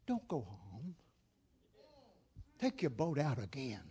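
low, hushed, measured instruction; quiet command. Retold or imitated. Jesus sending weary Peter back out, quiet command. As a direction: say it softly and low, with calm authority.

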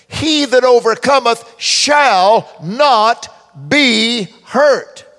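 loud, slow, high proclamation; full-force preacher declaring scripture; preaching at full force. Acted. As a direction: proclaim the verse to the crowd. Preaching the verse again.

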